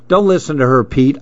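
usual speaking voice

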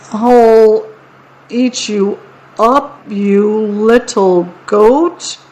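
halting small troll voice; drawn out, rising uncertainly at the end. A narrator's reading. Troll tries threatening the big goat, small-voiced. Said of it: "in a voice that sounded quite small".

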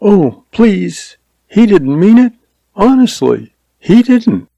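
put-on high-pitched pleading young woman; shaken pleading. A narrator's reading. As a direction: plead for him, high and upset. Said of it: "Her voice shook".

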